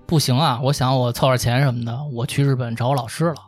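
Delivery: normal talking voice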